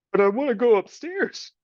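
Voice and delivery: put-on a scrappy kid asking hopefully; rough little girl. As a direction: Meg asking the rat about her future. Meg's voice.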